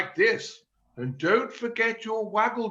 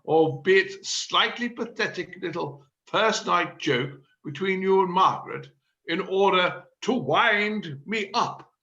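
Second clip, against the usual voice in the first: ordinary delivery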